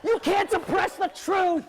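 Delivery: high, loud, slow protest; dramatic shout. Acted. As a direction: proclaim it like a protester. Elf protests his unpaid job.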